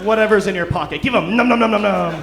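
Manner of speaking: loud, high, hammed-up pill gobbling; greedy gobbling. Florida sober comic acts out taking any pills, greedy gobbling. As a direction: gobble it up like a cartoon glutton.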